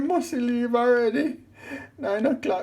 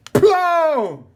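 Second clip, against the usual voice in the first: shout; 4 semitones higher, 7 dB louder than usual. Acted. Blow lands on the cat.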